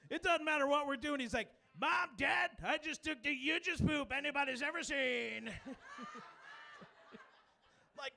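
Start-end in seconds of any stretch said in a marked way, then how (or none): 1.8-5.5 loud, proud Trump-style boast; Trump press conference. Retold or imitated. Little son bragging at dinner like Trump, loud and proud. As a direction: announce it like a president at the podium. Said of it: "comes out like Donald Trump in a press conference".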